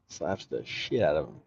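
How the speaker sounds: repeats it again, laughing now; chuckling through the words; laughing retelling. Retold or imitated. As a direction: laugh the punchline out.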